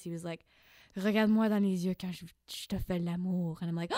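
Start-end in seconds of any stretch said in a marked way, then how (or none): 0.9-3.6 a hint of a seductive French murmur; lightly voiced sexy French lover. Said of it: "in a very sexy French voice". 3.6-3.9 surprised gasp. Retold or imitated. Shocked reaction gasp.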